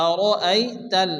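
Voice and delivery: আরাইতাল drawn out very slowly with doubled lam; slow tajweed demo. Shown as a demonstration. Teacher modelling আরাইতাল slowly for students. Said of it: "লাম জবর তাল".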